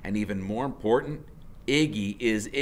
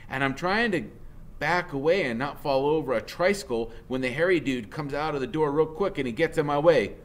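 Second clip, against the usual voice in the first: usual speaking voice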